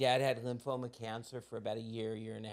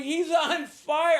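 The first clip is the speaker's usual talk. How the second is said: put-on high, slow, loud child cry; hammed-up little girl yelling; little girl alarm; 14 semitones higher, 8 dB louder, much slower than usual. Little girl from the Philippines, mock alarmed yell.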